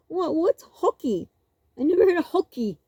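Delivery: high, fast mock disbelief; teasing mock confusion. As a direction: play dumb, teasing. Mocking cousin's pronunciation.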